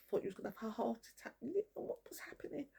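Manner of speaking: whispered jeer at the man who wronged her; mocking whisper. Real, not acted. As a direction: hiss the taunt like a secret. Mocking Lee's hospital scare.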